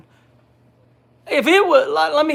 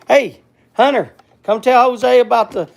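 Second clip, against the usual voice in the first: louder call to someone across the room; 3 semitones higher, 7 dB louder than usual. Real, not acted. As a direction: raise the voice to call Hunter over. Calling out to Hunter.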